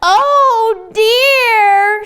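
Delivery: high, loud oh dear; dismayed animals. Acted. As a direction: exclaim it in dismay. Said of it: "said the animals".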